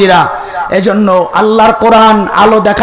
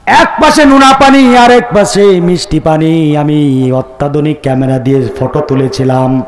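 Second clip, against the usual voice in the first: solemn doctor; 7 semitones lower than usual. Acted. Doctor recounting his photo in the sea, solemn. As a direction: chant sinking to a low monotone. Voices doctor, sinking chant.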